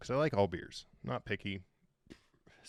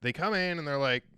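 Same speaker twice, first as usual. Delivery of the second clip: ordinary talk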